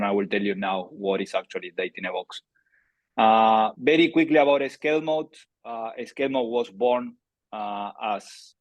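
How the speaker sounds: usual speaking voice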